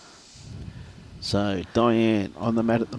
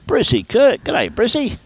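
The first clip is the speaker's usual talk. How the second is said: high, louder hello; cheery greeting; 9 semitones higher, 5 dB louder, slower than usual. Real, not acted. Commentator hailing a listener who won, high and delighted. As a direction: sing out the g'day.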